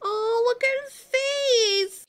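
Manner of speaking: high, slow and near tears; teary pity. Real, not acted. Vlogger aching for the doomed baby, teary and slow. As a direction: let the pity choke the words a little. Upset over the baby's death.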